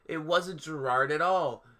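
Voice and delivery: normal talking voice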